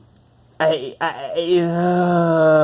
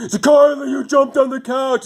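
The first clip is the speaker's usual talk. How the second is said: higher, louder hammed-up scolding; mock outraged stepdad; 10 semitones higher, 4 dB louder than usual. Mimicking the stepdad's fit over the couch, higher and louder. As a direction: overblown shock at the dog. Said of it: "And it's like".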